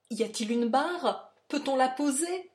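pressing, alarmed appeal; urgent alarm. A narrator's reading. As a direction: ask in a panic for safety. Fugitive barring door against attacker.

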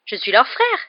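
indignant outburst, loud and high; scandalised. A narrator's reading. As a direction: protest as the wronged brother. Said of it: "dit Ron scandalisé".

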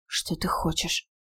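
slow strained whisper through pain. A narrator's reading. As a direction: whisper it, hurting. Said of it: "с трудом прошептала она через боль".

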